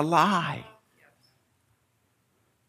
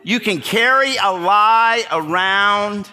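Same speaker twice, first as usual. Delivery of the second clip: slow, louder and higher; slow preaching; 8 semitones higher, 4 dB louder, slower than usual. Real, not acted. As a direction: lean on each word.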